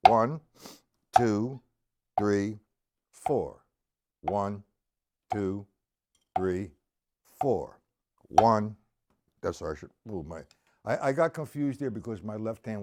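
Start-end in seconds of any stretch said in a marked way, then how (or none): 0.1-8.8 slow, low-pitched counting with even gaps; nice and slow count. Shown as a demonstration. Drum teacher demonstrating line one again, slow measured count. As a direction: count each quarter note separately, steady as a metronome. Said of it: "nice and slow in quarter notes".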